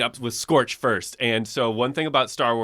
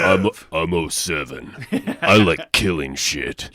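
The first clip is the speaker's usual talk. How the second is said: low, slow mock brooding soldier; gloomy killer clone, slowly; edgy soldier voice; 6 semitones lower, slower than usual. Imitating Sev character.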